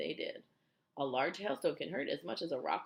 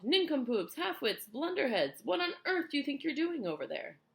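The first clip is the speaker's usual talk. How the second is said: loud, high string of insults; raised, punchy heckling; yelling; 11 semitones higher, 7 dB louder than usual. A narrator's reading. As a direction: hurl each word across the sky. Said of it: "yelling at the cloudmen as loud as he could".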